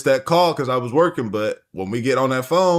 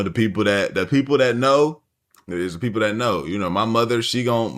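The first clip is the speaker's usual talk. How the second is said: usual speaking voice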